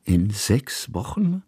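slow, doubting echo of the words; puzzled repeat. A narrator's reading. Kusmin echoes the girl, slowly and in doubt. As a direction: draw it out as a disbelieving question. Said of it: "wiederholte Kusmin".

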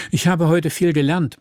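earnest. A narrator's reading. Thomas tells Emmy what the day taught him, earnest. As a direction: say it with careful, emphatic seriousness. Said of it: "so ernst wie möglich".

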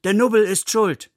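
wild crowd cry. A narrator's reading. Everyone in the crowd suddenly blames the Nubbel, crying wildly. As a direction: cry it out high like a roused crowd. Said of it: "alle riefen plötzlich wild".

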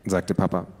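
plain reading voice

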